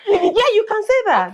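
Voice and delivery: high laughing encouragement. Real, not acted. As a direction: laugh through the words.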